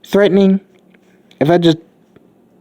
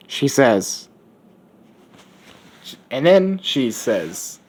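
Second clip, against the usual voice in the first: ordinary delivery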